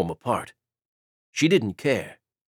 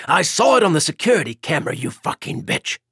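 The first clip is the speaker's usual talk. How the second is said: loud, fast, furious accusation; enraged lover caught her on camera; thundering rage; 6 semitones higher, 4 dB louder than usual. Acted. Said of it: "Keegan thundered".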